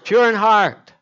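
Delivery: preacher's ringing cry; shouted proclamation. Acted. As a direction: call it out to the whole church. Shouted climax of sermon.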